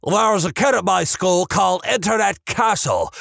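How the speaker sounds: normal talking voice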